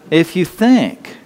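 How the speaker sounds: "if you think" drawn out slowly, each word weighted; slow down and lean on "if you think"; slow, pointed. Shown as a demonstration. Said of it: "But if you think".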